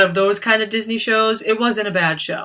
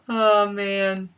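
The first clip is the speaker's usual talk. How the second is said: ordinary delivery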